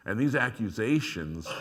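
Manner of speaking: ordinary talk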